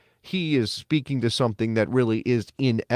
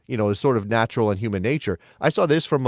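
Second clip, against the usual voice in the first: ordinary talk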